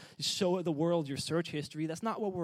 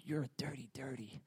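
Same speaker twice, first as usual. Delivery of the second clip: whispered, much softer gossip; softly whispered mock scorn; 5 semitones lower, 10 dB quieter than usual. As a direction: hiss it like a scandalized gossip. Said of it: "He doesn't, he doesn't say".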